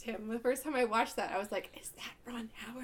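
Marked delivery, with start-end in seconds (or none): 1.8-2.8 hushed, breathy disbelief; stunned whisper. Retold or imitated. Viewer recalling first spotting Ron Howard, stunned whisper. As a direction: whisper it in retold astonishment. Retold stunned reaction to cameo.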